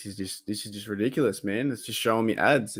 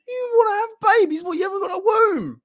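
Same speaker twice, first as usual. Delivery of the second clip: squeaky voice, much higher, rising in mock shock; high falsetto disbelief; 4 dB louder than usual. Fan quoting a comedy skit, squeaky high shocked question. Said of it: "And he's like".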